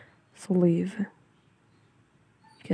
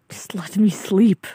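slow, loud, exasperated plea; exasperated yell; 2 semitones higher, 7 dB louder, slower than usual. Acted. Sleepy speaker begs to be left in bed, exasperated yell. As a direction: raise your voice, stretching out the plea. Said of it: "didn't mean to yell at you".

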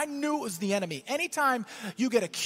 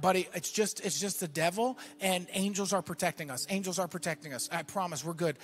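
softer, lower voice for his son; lowered, soothing dad voice; calm reassurance; 5 semitones lower, 4 dB quieter than usual. Retold or imitated. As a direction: reassure a small child quietly. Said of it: "I'm trying to stay calm".

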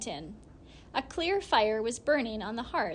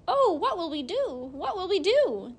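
frightened frogs; 7 semitones higher, 5 dB louder than usual. Acted.

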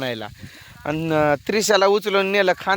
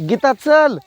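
loud call across the field, pitched up; 7 semitones higher, 4 dB louder than usual. Real, not acted. Calling Geeta across the field to hurry, loud call. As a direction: call her over from a distance.

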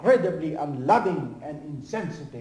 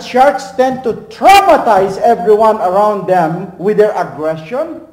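preaching at full force; 4 semitones higher, 13 dB louder than usual. Acted. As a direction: thunder it from the pulpit.

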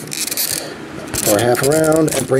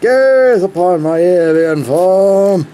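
pompous alien warning; 7 semitones higher, slower than usual. Pretend. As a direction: grandly warn the child of doom. [name] voice bit again.

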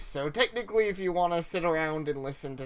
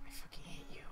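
under-the-breath frustrated whisper; whispered curse; 12 dB quieter, faster than usual. Real, not acted. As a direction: hiss it quietly to yourself. Muttered frustration at game puzzle.

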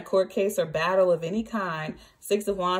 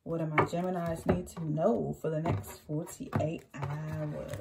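slow, softer put-on Australian accent; a mock Australian accent, asking the cards slowly; 2 semitones lower, 4 dB quieter than usual. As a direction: drawl it out in a playful Australian accent. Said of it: "I don't know why I put on that Australian accent".

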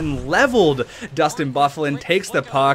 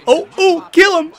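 high, loud egging on; excited urging; 12 semitones higher, 8 dB louder than usual. Real, not acted. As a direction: fire up your player mid-rush. Urging his player in a rush.

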